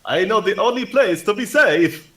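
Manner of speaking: put-on high, loud vampire accent; hammed-up Vlad-style vampire accent; comedy vampire accent. Player trying to lead civilians to safety, comedy vampire accent. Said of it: "your comedy accent".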